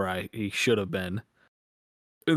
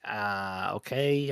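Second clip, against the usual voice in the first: pissy, grudging okay, pitched up at the end; imitating pissy woman; much slower than usual. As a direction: mimic someone getting mildly huffy. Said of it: "she got all fucking pissy".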